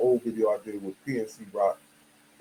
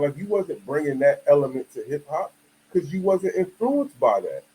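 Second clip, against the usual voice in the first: ordinary talk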